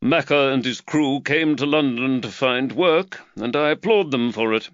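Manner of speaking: a hint of a harried employer fretting over costs; Reed the magistrate. A narrator's reading. Reed voiced by narrator.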